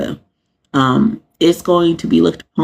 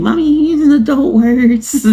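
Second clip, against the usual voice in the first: imitating her son; 10 semitones higher than usual. Mother imitates her kid scolding her cussing, high child voice. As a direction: play the little boy scolding mommy. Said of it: "he'll be like".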